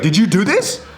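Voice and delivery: high mock-accusing mom voice; mom's accusation. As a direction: confront the kid as an imitated mom. Mom confronts son again.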